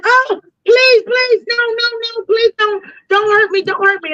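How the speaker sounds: very high-pitched mock begging; imitating panicked pleading. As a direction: plead frantically in a squeaky-high voice, acting him out. Frightened man begging in retold encounter.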